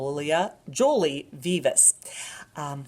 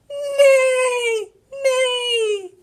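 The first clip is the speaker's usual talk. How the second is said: high whinnying neigh; whinny like a horse; 9 dB louder than usual. Acted. What does the horse say?.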